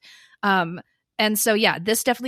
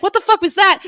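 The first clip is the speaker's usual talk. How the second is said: mock startled; 10 semitones higher, 9 dB louder than usual. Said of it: "instead of being like".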